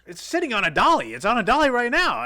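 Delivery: loud, high, incredulous retold protest; exasperated yelling. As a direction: shout the obvious in exasperation. Acted exasperated reply about the tripod.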